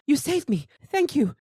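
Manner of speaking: high, breathless thanks; grateful victim. Acted. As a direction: gush at your rescuer. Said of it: "the woman who was attacked ... goes".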